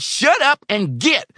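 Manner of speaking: very high, loud order; furious scream. A narrator's reading. Old man to confused helper, screaming to get out. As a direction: scream him out of the room.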